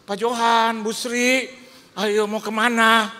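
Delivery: high, loud mock welcome to the pastor; loud greeting. Church host calling out to the pastor, loud and high. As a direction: call out brightly like an eager host. Greeting hosts loudly in retold scene.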